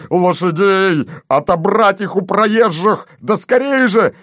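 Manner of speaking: high, frantic command rising; urgent shouted orders. A narrator's reading. Police chief demanding horses at once, frantic shouting. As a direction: build to a very high final shout.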